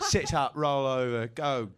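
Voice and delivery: a hint of a stern dog trainer; mock dog commands. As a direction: boss him around like a dog trainer, half joking. Mocking commands to an animal.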